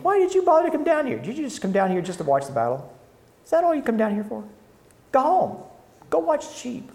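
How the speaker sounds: high, fast taunting jeer; mocking brothers. Brothers taunting David to go home, high mocking jeer. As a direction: sneer him off the field. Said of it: "the two brothers are like".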